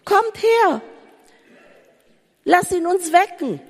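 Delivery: high, loud, frantic cries for help; panicked crying out. Acted. Disciples crying to the Lord in the storm, panicked and loud. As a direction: shout in terror as the boat sinks. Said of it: "trotzdem schreien sie".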